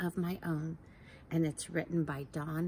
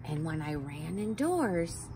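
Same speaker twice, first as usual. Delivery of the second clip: bright, higher storybook reading; reading to children; 5 semitones higher than usual. A narrator's reading. Teacher reads a rainbow book to kids, lively. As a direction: read it like a picture book to little ones.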